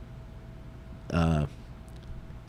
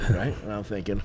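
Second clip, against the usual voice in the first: ordinary talk, nothing marked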